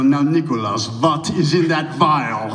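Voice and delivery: imitated German accent, slow and deliberate; measured Bavarian-accented question, drawn out at the end; Werner Herzog. Director questioning his actor about a vial, imitated German accent. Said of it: "in her thick German accent".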